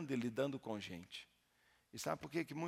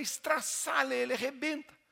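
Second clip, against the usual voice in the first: higher, louder battle command; commanding shout; 13 semitones higher, 9 dB louder than usual. Retold or imitated. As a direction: order it like a fighting preacher. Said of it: "Eu falei".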